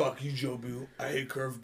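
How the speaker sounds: mumbled quote. Retold or imitated. Quoting movie character line.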